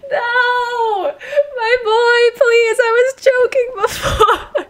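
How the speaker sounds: high, loud cry of dismay; tearful wail. Real, not acted. Player learning a favorite character is dead, wailing tearfully. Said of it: "I'm gonna cry".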